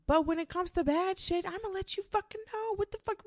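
indignant. Real, not acted. Venting to viewers about bad stuff, high indignant squeal. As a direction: pitch shoots high, voice thins.

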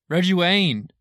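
excited realization. Real, not acted. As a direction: blurt the name out as it comes back. The name suddenly remembered.

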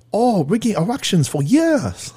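mock Chinese accent. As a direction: deliver the punchline again in a hammed-up Chinese accent. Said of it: "that joke in a Chinese accent".

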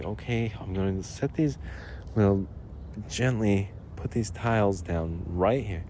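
put-on soft, careful, as if laying a baby down; gentle and delicate. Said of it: "he just gently, ... delicately, like putting a baby down".

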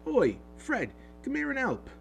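high, loud call across the platform. A narrator's reading. Twin calling his brother to help lift the trunk, loud call. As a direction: shout for your brother to come.

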